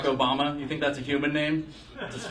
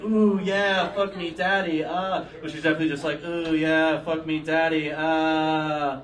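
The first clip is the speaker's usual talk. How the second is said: a hint of bored faked moaning; a lightly voiced tired voice actor moaning; mock porn moan; 2 semitones higher, slower than usual. Voice actress faking for money, lightly voiced bored moan.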